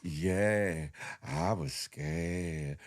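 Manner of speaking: very low, very slow, quiet; deadpan with no fear at all; deep flat fake-scared drawl; mock nonchalant. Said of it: "She was like".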